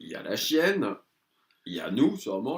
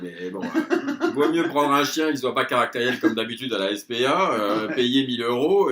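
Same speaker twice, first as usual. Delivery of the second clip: louder, words through laughter, slow start; laughing while talking; 3 semitones higher, 9 dB louder than usual. Real, not acted. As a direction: push the advice out through giggles. Rit en racontant son conseil. (en riant, plus fort)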